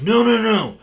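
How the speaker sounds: high, loud string of no's; alarmed stopping. Retold or imitated. Staff stop the patient leaving the wheelchair, loud protest. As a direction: imitate staff urgently calling him back, pitch way up.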